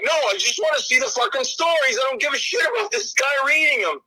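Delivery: high, shouted impatient gripe; imitated audience outburst; mock fed-up viewer. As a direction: complain like an irritated fan.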